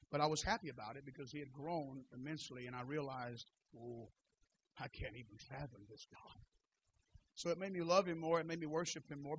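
hushed confiding tone; soft intimate telling. Real, not acted. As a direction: lean in and speak quietly. Intimate soft storytelling after shouting.

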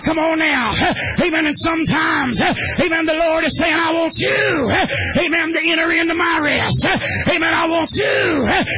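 chanted preacher's cadence, half shouted; whooping holiness sermon; preaching at full force. Real, not acted. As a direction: drive it home like a revival preacher.

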